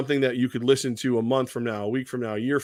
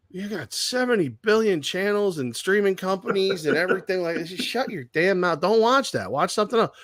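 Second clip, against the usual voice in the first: exasperated rant; 7 semitones higher than usual. Real, not acted. Ranting at complainers.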